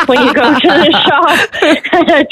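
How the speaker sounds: slow, high, laughing through the words; words half lost in real laughter. Real, not acted. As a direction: giggle your way through it. Laughing through end of question.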